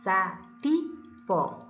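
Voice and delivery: "Satipo" very slowly, sa, ti, po; syllable by syllable. Shown as a demonstration. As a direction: break "Satipo" into three syllables with clear pauses. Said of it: "Vamos a silabear". ("Satipo" silabeado lentamente: sa, ti, po)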